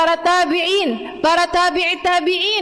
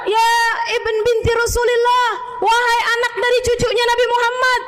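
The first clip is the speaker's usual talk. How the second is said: panicked shouting; 10 semitones higher than usual. Retold or imitated. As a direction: shout for him to see the fire. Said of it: "Orang-orang pada teriak-teriak". (teriakan panik, tinggi dan keras memanggil Tuan)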